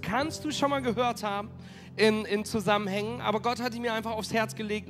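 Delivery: ordinary talk, nothing marked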